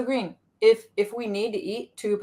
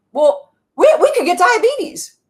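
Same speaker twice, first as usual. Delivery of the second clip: much higher, louder fretting voice; a high anxious protest; worried manager part; 10 semitones higher, 9 dB louder than usual. Acted. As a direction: whine like a frightened inner part.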